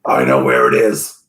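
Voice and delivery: very low growly servant; Hargle. Acted. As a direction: growl it far below your own pitch. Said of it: "says Hargle".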